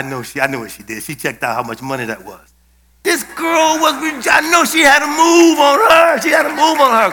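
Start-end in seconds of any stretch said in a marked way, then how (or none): 3.0-7.1 higher and louder, bursting with delight; excited shout. Real, not acted. Preacher telling the congregation about the orderly, excited shout. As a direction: shout it out, thrilled.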